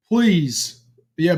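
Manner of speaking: ordinary delivery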